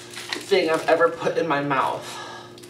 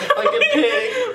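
laughing. Real, not acted. Burning mouth, laughing.

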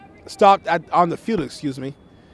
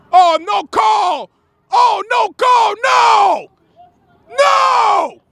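very high, drawn-out cries of protest; outraged shout; 12 semitones higher, 3 dB louder, much slower than usual. Real, not acted. Commentator protesting a missed call, high outraged shouting. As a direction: wail your disbelief at the referee, high and long.

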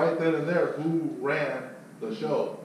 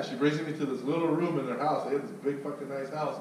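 ordinary delivery

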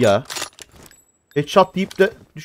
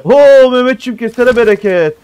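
excited cheer; 4 semitones higher, 6 dB louder than usual. Real, not acted. Cheering a teammate's kill.

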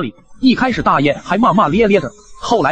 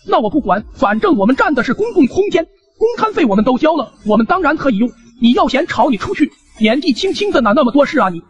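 put-on higher-pitched, indignant old man's scolding; grumpy old man; 8 semitones higher than usual. Old neighbour lecturing the young man about the hallway, indignant and higher-pitched. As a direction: play a cranky old neighbour laying down the law.

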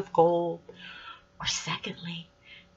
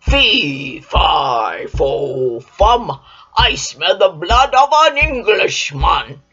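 loud, lower booming giant voice; thundering giant; 4 semitones lower, 8 dB louder, slower than usual. Acted. Giant sniffing for Jack again, loud and booming. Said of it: "he thundered".

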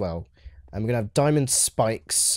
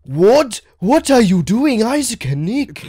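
high, loud, drawn-out cry; imitated shocked yell at two culprits; mock outraged reaction; 10 semitones higher, 9 dB louder, slower than usual. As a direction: play the indignant victim, stretching the words. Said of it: "He's gonna be like".

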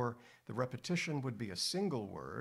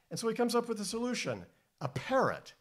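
mock surprise; 12 semitones higher, 6 dB louder, faster than usual. Playful reveal of the parrot.

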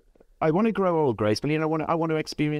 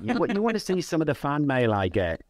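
normal talking voice

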